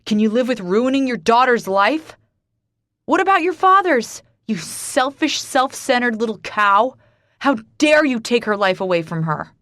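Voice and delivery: enraged shouting rant; furious roar. Acted. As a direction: tear into her, roaring. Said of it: "he roars".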